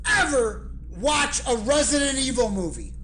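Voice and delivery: normal talking voice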